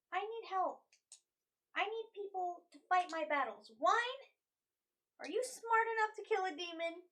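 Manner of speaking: worked-up, loud appeal; desperate plea. Acted. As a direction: plead loudly for someone to help. In-character plea for help.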